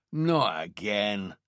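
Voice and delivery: a slow, drawn-out groan of complaint; complaining. Acted. Detective moaning to his boss about another case, slow weary complaint. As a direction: drag the words out like a weary protest. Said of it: "Donnelly complained".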